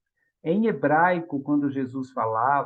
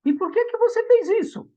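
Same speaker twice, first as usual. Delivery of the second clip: much higher, louder questioning voice; a teacher probing kindly, pitched way up; teacher asking; 4 dB louder, faster than usual. Retold or imitated. Teacher asking the boy why he pulled her hair.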